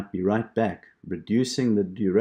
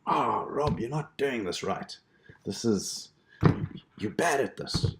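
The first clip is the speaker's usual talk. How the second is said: self-scolding; 3 semitones higher than usual. Retold or imitated. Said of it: "I was upset with myself, saying".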